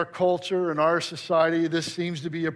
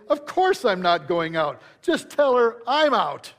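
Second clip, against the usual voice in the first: impatient boss snapping back, higher and slower at the end; a read-aloud curt rebuke, rising to higher pitch; sharp reply; 6 semitones higher, 4 dB louder than usual. Acted. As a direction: boss losing patience, a touch sharp. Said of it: "replied sharply".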